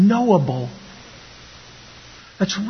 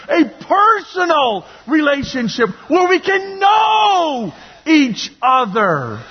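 preaching at full force; 6 semitones higher than usual. Real, not acted. Preacher building to a shout.

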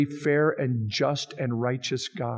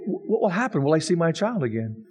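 ordinary talk, nothing marked

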